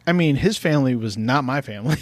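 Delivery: ordinary talk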